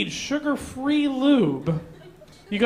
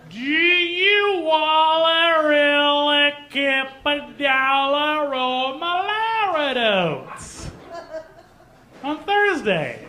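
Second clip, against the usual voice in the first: louder, slow, exasperated gibberish; yelling the gibberish at the confused friend; mock angry shout; 6 semitones higher, 4 dB louder, much slower than usual. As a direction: bellow it like you've lost all patience. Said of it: "more and more angry at them".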